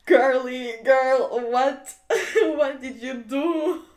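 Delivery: loud, drawn-out disbelief breaking into laughter; shocked laughing outburst. Real, not acted. Scolding the storyteller over the harsh jab, laughing in shock. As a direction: exclaim slowly and loudly through real laughter.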